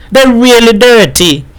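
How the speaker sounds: high-pitched, emphatic admiration; proud. A narrator's reading. Wife admires husband's greasy clothes, proud. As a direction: confirm it admiringly on a high note. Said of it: "regarded her husband with pride".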